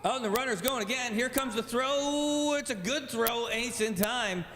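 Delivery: excited play-by-play. Acted. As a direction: pitch jumps way up on the throw. Live call of a steal.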